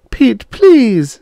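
very high, loud plea; almost screaming. A narrator's reading. Beth pleads with her husband, almost screaming. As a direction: cry out just short of a scream. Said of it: "almost screaming".

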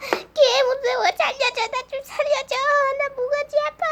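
high, small frightened voice; scared whimpering plea. Acted. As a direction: whimper for rescue. Scared, begging for help.

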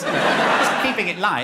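ordinary talk

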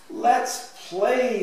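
put-on high child's squeal; mild storybook squeal of delight; excited squeal. A narrator's reading. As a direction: call it out high like an eager kid. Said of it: "squealed Sandy".

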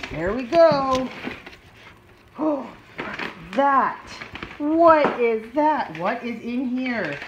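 gasping, loud, stretched-out wonder; emphatic excited reveal; excited pet talk. Real, not acted. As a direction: hype up the dog with big gasps. Revealing the box to the dog.

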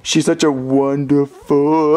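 low, slow, forced praise; unwilling compliment, stretched thin; straining reluctance. Acted. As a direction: drag out the last word, stuck. Cannot finish the compliment.

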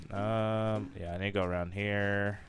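ordinary talk, nothing marked

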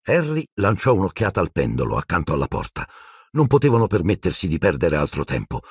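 ordinary delivery

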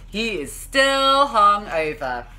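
but he is still hungover, dragged out slowly; storybook refrain, dragged out. A narrator's reading. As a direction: draw out still like a weary chorus.

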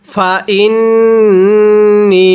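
ফা ইন্নি with ন্ন hummed long through the nose; ghunnah. Shown as a demonstration. Teacher demonstrates nasal ghunnah, slow. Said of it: "নাকের বাসি দ্বারা গুন্নাহ করব".

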